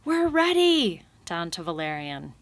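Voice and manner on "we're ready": a high, loud shout down the hill. A narrator's reading. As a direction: call out to Valerian, high and loud. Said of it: "finally shouted".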